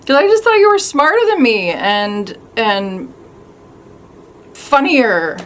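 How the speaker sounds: loud, emotional outburst easing off; hurt confrontation. Acted. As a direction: let the hurt out loudly, with halting pauses. Acted hurt confrontation in monologue.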